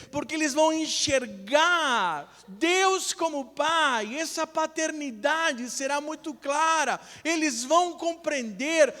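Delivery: high, loud sermon climax, building steadily; impassioned preaching. Real, not acted. Preacher urges fathers to seek God, full-force impassioned preaching. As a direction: drive it home to the congregation, stretching key words.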